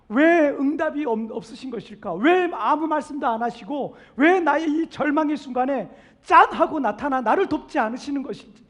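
loud, lifted cry of why; anguished questioning. Acted. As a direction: demand answers from a silent God. Acts out the psalmist's anguished questioning.

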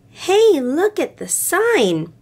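put-on high, slow, pointing-out child's voice; little girl Lisa. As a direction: stretch it out like a curious child.

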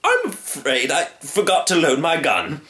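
awkward storybook hunter; embarrassed hunter. Acted. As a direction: confess sheepishly in a put-on voice. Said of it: "the hunter was very embarrassed".

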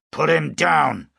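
loud, hard order; storming command. A narrator's reading. Shano storms at Broom to bury the body. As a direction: Put him down, barked with fury. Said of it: "stormed Shano".

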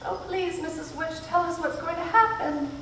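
put-on high, soft child's plea; pleading child. A narrator's reading. Children pleading with Missus Witch, high and soft.

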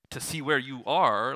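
ordinary talk, nothing marked

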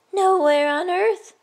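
put-on high, light boy's voice; small boy speaking up to a king. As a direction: answer as a young boy, gently.

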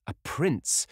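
high, lilting exclamation; musical cry. A narrator's reading. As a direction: sing out the word in delighted admiration. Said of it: "she cried musically".

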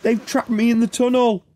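very high, sustained wail of terror; panicked shout. A narrator's reading. Rheneas believes a ghost engine trapped him, panicked shout. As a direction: cry out high as if cornered. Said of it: "shouted Rheneas".

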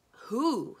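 loud, high-pitched eager question. A narrator's reading. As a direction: pounce on it eagerly. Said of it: "said Aramin eagerly".

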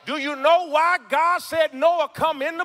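high, loud whooping preacher; preaching. Acted. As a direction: preach it to the rafters. Preaching at full force.

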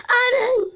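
whiny wail of anam; a high fake sob for mom; mock crying wail. Real, not acted. Cry while gaming.

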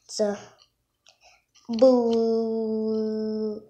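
ordinary talk

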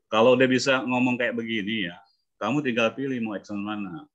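ordinary delivery

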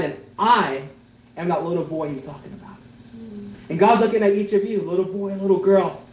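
ordinary talk

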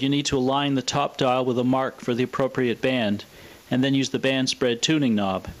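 ordinary talk, nothing marked

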